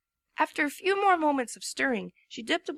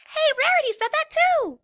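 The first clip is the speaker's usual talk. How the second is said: high, loud, bright; high cheerful pony; chirpy Pinkie; 14 semitones higher, 7 dB louder than usual. A narrator's reading. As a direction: pipe up brightly. Pinkie voiced.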